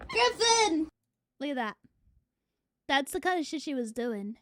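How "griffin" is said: calling the dog. Real, not acted.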